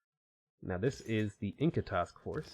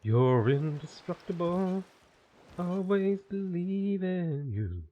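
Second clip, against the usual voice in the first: cheerleader voice; 8 semitones higher, 4 dB louder, slower than usual. Pretend. Cheering on own units.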